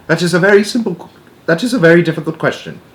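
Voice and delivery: fast, high falsetto of a nervous spy. Acted. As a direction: chatter it in a fast put-on falsetto. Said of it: "and a falsetto voice".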